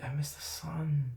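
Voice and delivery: slow whisper, high and wistful; whispered longing. Retold or imitated. Recalling his first thought on a gloomy trip, whispered. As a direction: whisper it like a homesick wish. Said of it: "first thing going on in my mind".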